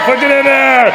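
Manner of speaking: high-pitched shout egging someone on; rowdy cheering shout. Real, not acted. Host urges Lexi on with the shot, rowdy shout. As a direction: yell it over a roaring crowd.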